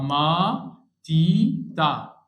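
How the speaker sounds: very slow, split ما تي تا; syllable by syllable. Shown as a demonstration. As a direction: break ماتيتا into syllables. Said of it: "هنا آ". (مقطع مقطع ببطء شديد)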